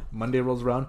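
ordinary delivery

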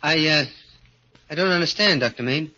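high, pleading little man's voice; a small frightened acrobat begging his boss; timid little man. Acted. Little acrobat pleading with strongman Petroff, high and anxious. Gorgo puzzled by the doctor.

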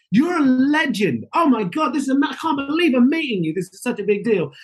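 overflowing with awe; gushing. Retold or imitated.

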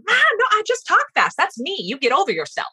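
high, dismissive brush-off; defensive brush-off. Retold or imitated. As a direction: wave the criticism away. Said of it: "I could be like".

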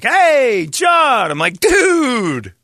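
high, loud fake cheer; overeager greeting. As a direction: greet like an old friend.

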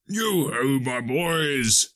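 hearty call in a changed voice; jovial Fezziwig. Acted. Old Fezziwig hails his boys, jovial calling voice. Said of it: "said Fezziwig".

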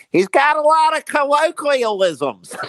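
high, slow, hammed-up Philly accent. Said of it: "with a Philly accent".

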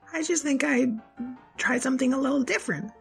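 put-on high kid voice. Child voice.

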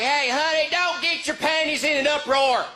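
high, mocking Walmart shopper voice; Walmart fight. Walmart couple fighting, high mock voice. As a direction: needle your partner in a high voice. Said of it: "It's like".

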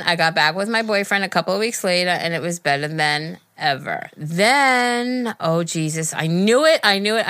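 ordinary talk

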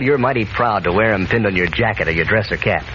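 normal talking voice

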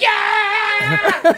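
high whooping yeah; excited shout. Retold or imitated. Friends retell cheering at a stranger, high whoop. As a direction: whoop at the passing car. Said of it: "we're like".

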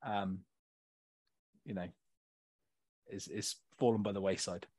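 low, hushed, sheepish admission; subdued apology. Real, not acted. Co-host apologises to friend for his poor admin, subdued. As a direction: own up quietly, voice sinking low.

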